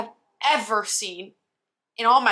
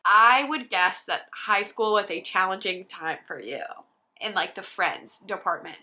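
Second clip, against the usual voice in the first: ordinary delivery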